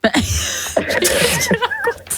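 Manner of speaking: high, slow laughing disbelief. Real, not acted. Laughs at host's claim.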